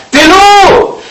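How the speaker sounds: very high, loud scream; screaming the dog's name. Acted. Girl screaming for the runaway dog Télo, terrified. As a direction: scream the name across the field. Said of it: "et hurla".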